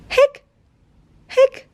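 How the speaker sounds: sharp high hiccup sound; a little squeaky hic. Acted. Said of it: "said Keeper".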